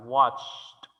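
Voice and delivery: watched drawn out, ending CH-T; watched as "watcht", one syllable; final T sound. Shown as a demonstration. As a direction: add a separate T after the CH of watched. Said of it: "se finalizaría con una T".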